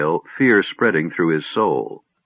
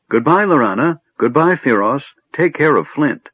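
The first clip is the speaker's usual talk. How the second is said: high, louder bright farewells; cheerful kender goodbye; 8 semitones higher, 3 dB louder than usual. Acted. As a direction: call out goodbyes.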